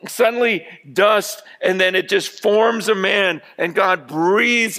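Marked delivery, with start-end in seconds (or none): none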